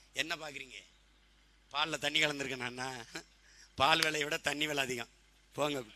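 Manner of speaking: low, hushed character aside; soft quoted reply. Acted. Milk seller answering the customer checking for water, soft reply. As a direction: drop the voice and answer quietly.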